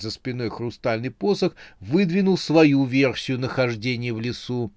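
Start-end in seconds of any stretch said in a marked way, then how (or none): none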